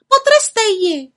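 high, loud egging-on; goading witches. A narrator's reading. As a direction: needle her into it. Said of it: "popichovaly druhé hromnice".

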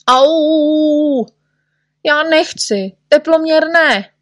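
high, whining protest; high-pitched acted panic; wailing refusal. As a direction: howl and plead against it. Patient refuses the thermometer.

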